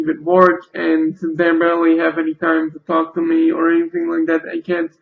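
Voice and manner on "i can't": ordinary talk, nothing marked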